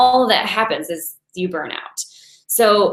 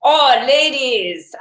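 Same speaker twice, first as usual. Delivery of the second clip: put-on Russian accent; 4 semitones higher, 3 dB louder, much slower than usual. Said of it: "in Chinese in a Russian accent".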